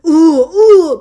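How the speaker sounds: loud, hammed-up swooning ooh; mock swooning. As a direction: swoon over the alpha male, overdone. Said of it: "I'm not gonna sit here and be like".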